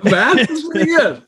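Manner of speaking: high, laughing approval. Real, not acted. Co-host reacting to the transformer joke, laughing. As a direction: crack up while saying it.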